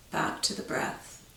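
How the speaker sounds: soft whisper fading to breath. Acted. Drawing the class back to the breath, hushed whisper. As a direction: breathe the words out barely voiced.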